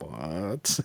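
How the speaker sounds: hushed, low, stunned question; mock disbelief. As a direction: let it drop almost to nothing, as if dumbfounded. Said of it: "And you're just like".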